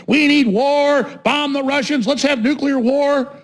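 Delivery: high, chanting mock war cry; mocking warmongers. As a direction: parody a crowd clamoring for a fight. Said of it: "leftists talking like that".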